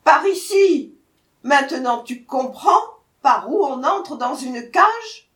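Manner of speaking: loud, high, exasperated tiger; impatient shout. A narrator's reading. Tiger jumping into the cage, shouting. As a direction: shout it out, out of patience. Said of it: "s'écria".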